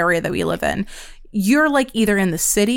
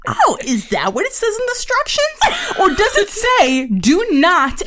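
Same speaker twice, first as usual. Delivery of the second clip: much higher, mock-sweet sarcasm; sarcastic retort; 11 semitones higher than usual. Mocking the delivery man who rang the doorbell, higher mock-sweet sarcasm. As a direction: needle him with fake innocence.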